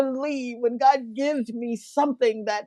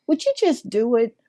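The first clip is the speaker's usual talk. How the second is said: ordinary talk, nothing marked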